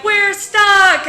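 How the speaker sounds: loud high yell from inside the elevator; shouting that we're stuck; shouting for help. Retold or imitated. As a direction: call out trapped and desperate. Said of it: "we're like".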